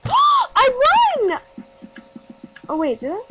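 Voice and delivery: high, loud shrieks of victory; shrieking jackpot winner. Real, not acted. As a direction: scream it like you hit the jackpot. Wins Sky Wars unexpectedly.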